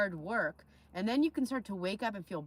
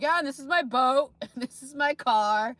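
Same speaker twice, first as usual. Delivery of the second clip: mock bragging; 5 semitones higher, 10 dB louder than usual. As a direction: ham up a fake rich braggart. Mocking David's online boasting.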